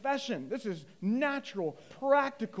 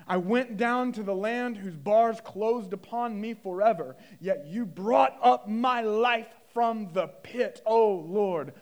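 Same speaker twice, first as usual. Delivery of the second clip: raised, louder preacher's reading; impassioned scripture reading; 2 semitones higher, 7 dB louder, slower than usual. Acted. Preacher reading Jonah's prayer to the congregation, impassioned. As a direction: declaim the verse to the congregation. Reads scripture at full force.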